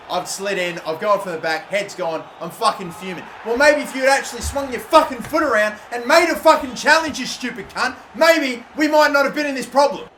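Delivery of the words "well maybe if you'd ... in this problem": louder, raised-pitch tirade; angry rant. Real, not acted. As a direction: lay into the defender. Ranting at his defender.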